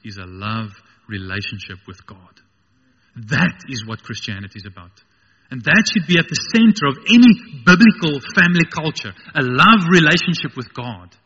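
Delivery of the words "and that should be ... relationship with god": loud, emphatic sermon declaration; full-force preaching. Real, not acted. Preacher declaring the centre of family culture, full-force preaching. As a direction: punch it out to the congregation.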